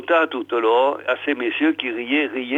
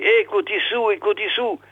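high, thin call to a sleeper; calling from outside; 7 semitones higher than usual. A narrator's reading. Peyrounet at the window waking the father, high calling. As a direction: call up high without much force. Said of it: "quand il entendit appeler".

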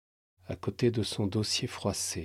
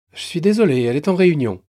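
plus haut et plus rapide, poli; débiter les consignes vite; guichetière pressée; 7 semitones higher, 10 dB louder, much faster than usual. Acted. Guichetière s'excuse. (guichetière qui expédie poliment)

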